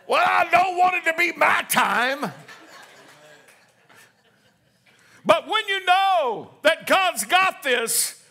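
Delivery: full-force preaching cadence; whooping preacher climax. Acted. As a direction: hold the notes like a whoop.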